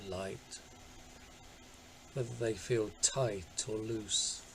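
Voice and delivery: calm body-scan guidance, long silences between phrases; guided meditation. Acted. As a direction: guide attention gently from toe to toe, unhurried.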